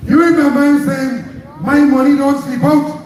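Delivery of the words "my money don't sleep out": higher, louder mock of an arrogant boast; hammed-up arrogant politician; mocking Keith Rowley. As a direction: throw his boast back at him with scorn. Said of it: "In the most arrogant, disrespectful style".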